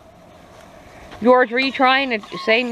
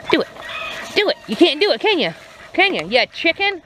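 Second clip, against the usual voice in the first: higher-pitched teasing dare; mock challenge, pitched up; playful taunt; 7 semitones higher than usual. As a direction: goad him like a playground tease. Daring rooster to say meow.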